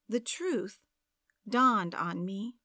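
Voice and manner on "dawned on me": plain reading voice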